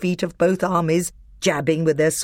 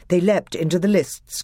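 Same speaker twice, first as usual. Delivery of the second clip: plain reading voice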